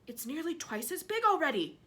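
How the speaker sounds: excited aunt shouting. Acted. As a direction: call it out over the garden. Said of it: "Aunt Spiker shouted".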